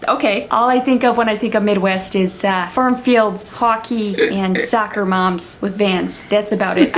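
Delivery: friendly, flattish Midwestern accent. Shown as a demonstration. Impressionist shows off a favorite, friendly Midwest accent. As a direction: chat away like a friendly Midwesterner. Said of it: "Midwestern accent, one of my favorites".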